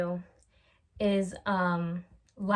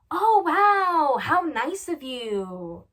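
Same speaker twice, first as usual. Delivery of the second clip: high, loud, drawn-out sarcasm; mock offended; 7 semitones higher, 7 dB louder, much slower than usual. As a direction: gush sarcastically, dragging it out. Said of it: "be so offended".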